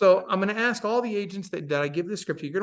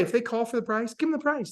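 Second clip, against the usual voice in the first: ordinary talk, nothing marked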